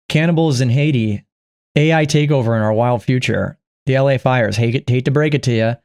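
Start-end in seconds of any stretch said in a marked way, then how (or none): none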